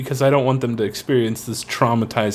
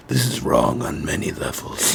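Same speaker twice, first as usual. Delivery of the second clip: dark anti-hero Charlie; 13 semitones lower, 4 dB quieter than usual. Retold or imitated. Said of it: "he's like".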